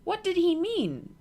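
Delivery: a hint of an eager young girl asking; Sophie. A narrator's reading. Sophie voiced in story.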